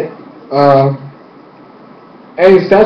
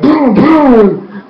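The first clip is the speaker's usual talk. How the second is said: high punched "boom, boom"; imitated explosions; 9 semitones higher than usual. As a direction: make two blast noises with your voice. Imitates firebending blasts.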